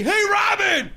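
high, loud mock shout; heckling yell from the couch. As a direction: holler at someone on the screen. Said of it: "yelling at the T V".

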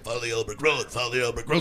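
low, put-on munchkin chant; little people. Little people giving directions to the traveller, low put-on voice. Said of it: "little people that were like".

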